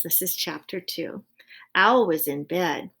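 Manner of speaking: ordinary delivery, nothing marked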